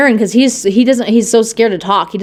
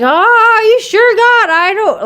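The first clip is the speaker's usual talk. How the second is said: doubting Moses; 13 semitones higher, 4 dB louder, slower than usual. Retold or imitated. Moses doubting God's call.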